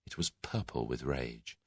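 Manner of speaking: ordinary delivery, nothing marked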